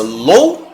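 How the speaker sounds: louco as "lou... co"; two separate beats in louco, ou together; syllable split. Shown as a demonstration. Teacher giving the right division, louco as lou... co. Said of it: "a separação de louco é essa".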